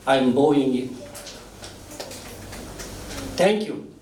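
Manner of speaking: usual speaking voice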